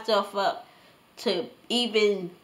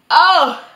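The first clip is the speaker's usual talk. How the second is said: loud sharp cry at the burn; pained yelp; 6 semitones higher, 11 dB louder than usual. Real, not acted. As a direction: yelp from the heat. Spicy gum burning.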